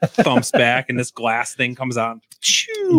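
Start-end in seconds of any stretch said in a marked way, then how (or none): 2.4-3.0 high sharp "Choo!" pop; whoosh sound effect. Retold or imitated. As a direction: make a quick swoosh noise. Imitating glass display popping out.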